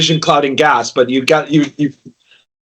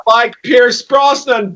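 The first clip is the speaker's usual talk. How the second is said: mock English accent; 7 semitones higher, much slower than usual. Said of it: "that's not an English accent".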